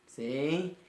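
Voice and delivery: usual speaking voice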